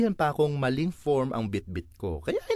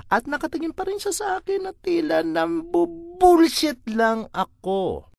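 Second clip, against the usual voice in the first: exasperated high squeak; 4 dB louder than usual. Acted. Exasperated retelling of clerk stare.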